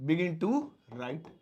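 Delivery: slow, careful teaching read; slow dictation. Shown as a demonstration. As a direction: read it out slowly for students. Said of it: "ऐसे ही begin वापस से begin हो जाएगा".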